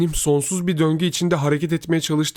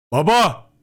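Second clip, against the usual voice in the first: loud, raised call; shouting; 5 semitones higher, 7 dB louder than usual. A narrator's reading. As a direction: yell out for a father. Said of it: "diye bağırdım". (yüksek sesle bağırarak)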